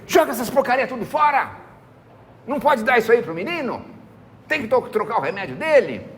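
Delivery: high, forceful command to throw the medicine out; doctor's order. Retold or imitated. Doctor telling residents to throw out the medicine, irritated command. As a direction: bark the order like an irritated chief.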